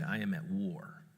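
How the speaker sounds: low, hushed, deadly serious; grave declaration. Retold or imitated. Dad repeating his declaration to child, grave. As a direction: repeat it quietly and firmly.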